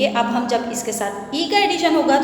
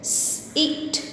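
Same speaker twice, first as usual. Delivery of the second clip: whispered word; 2 semitones higher, 8 dB quieter than usual. Shown as a demonstration. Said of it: "एक और word select किया है sit".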